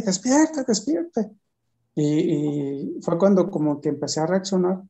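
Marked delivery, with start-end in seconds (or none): 0.0-1.3 higher, urgent "wake up"; higher imitation of friends trying to rouse him; urgent wake-up call. Companions shaking him awake.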